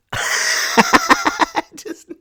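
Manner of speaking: high, slow laugh through the words; real laughter. Real, not acted. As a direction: crack up as you say it. Laughing at own smugness.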